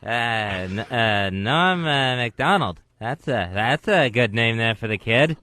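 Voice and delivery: low, halting celebrity impression; Norm MacDonald. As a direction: drop the voice and stumble between fillers, as if something is stuck in the throat. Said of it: "That's my Norm MacDonald impression".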